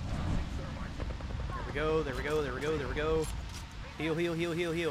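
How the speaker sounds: loud urgent coaxing; tense, breathless urging; being chased. Real, not acted. Urging teammate to heal mid-fight.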